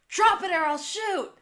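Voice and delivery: high, loud mock shout; acted armed warning. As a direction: shout the threat as the armed character. Acts out Jules threatening to shoot.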